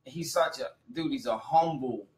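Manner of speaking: ordinary delivery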